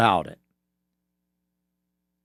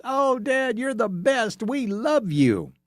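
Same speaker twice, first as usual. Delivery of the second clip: put-on much higher squeaky kid voice; mock five-year-old; 10 semitones higher, slower than usual. Kids cheering dad's Disney promise, squeaky high child voice. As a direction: play a gushing little kid, way up high. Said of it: "that five-year-old mentality".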